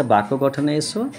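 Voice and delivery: normal talking voice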